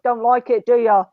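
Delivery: playful mock scolding; mock teasing. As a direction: poke fun at the fussy dog. Mocking the dog's disgusted face.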